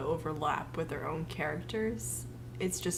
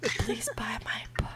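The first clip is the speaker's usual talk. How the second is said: ASMR whisper; 3 semitones higher than usual. Acted. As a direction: whisper the plug.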